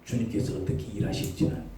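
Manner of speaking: low, whispered prayer; hushed prayer. Acted. Believer praying low and whispered to God. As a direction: pray it quietly.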